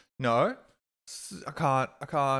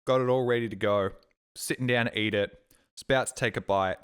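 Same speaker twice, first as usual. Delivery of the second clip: ordinary delivery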